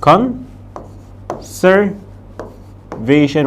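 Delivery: Conservation stretched out very slowly while writing it; slow modelling. Shown as a demonstration. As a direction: draw out Conservation for students. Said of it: "تعال نكتبها تحت هنا".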